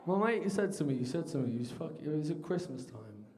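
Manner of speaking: normal talking voice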